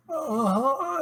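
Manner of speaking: high mock moan; mimicking a ghostly moan; imitated moan. Retelling the ghost noise, a high moan. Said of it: "it went from".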